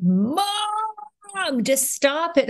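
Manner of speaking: imitated child's whine, pitched high; exasperated kids. Kids begging their mother to drop the act, exasperated. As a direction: stretch it out like a fed-up kid. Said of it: "the kids would hate it".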